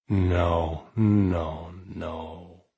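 slow, thick, stoned drawl. Acted. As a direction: drag each no out heavily. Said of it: "in the slowest, thickest voice".

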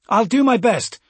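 loud, high military answer; radio reply. A narrator's reading. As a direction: answer up briskly over the comms. Major answers over the radio.

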